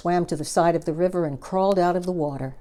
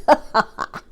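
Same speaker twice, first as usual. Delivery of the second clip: loud wicked laugh; croc laughs; 10 semitones higher, 5 dB louder than usual. Acted. Crocodile laughing out loud at the hippo. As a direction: cackle at the hippo. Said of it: "laughed out loud".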